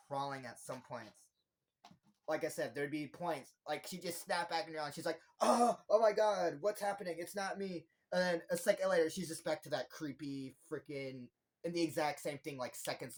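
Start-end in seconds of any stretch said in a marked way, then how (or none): 5.4-7.9 acted panic. Nikki snapping back to herself for seconds, panicked. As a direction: cry out in imitated panic.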